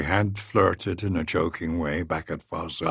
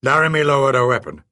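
ordinary delivery, nothing marked

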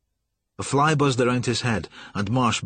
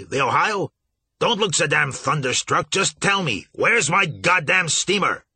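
higher, rising tirade; angry rant; 6 semitones higher than usual. Acted. Captain ranting at the agent about his steamer. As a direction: berate him, building to a roar.

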